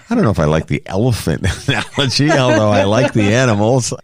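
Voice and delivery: laughing. Retold or imitated. Laughing at teasing nickname.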